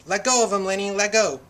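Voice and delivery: storyteller acting an alarmed yell; urgent shout. A narrator's reading. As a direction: shout to make him release. George acted shouting urgently at Lenny.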